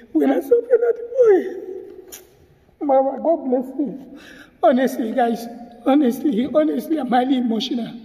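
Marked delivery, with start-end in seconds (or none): 0.1-4.2 real tears breaking through; crying. Real, not acted. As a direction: voice breaking into a sob. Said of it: "That is why I'm crying".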